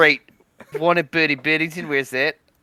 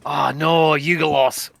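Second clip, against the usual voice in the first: mock Aussie dismay; Australian accent. Player lamenting another Yugoloth, Australian accent. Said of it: "good word to say in an Australian accent".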